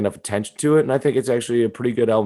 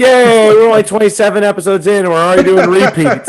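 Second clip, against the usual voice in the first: loud, high sarcastic hooray; mock cheer; 9 semitones higher, 9 dB louder than usual. Real, not acted. Host mocks own recycled podcast material, sarcastic cheer. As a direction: celebrate failure, very loudly.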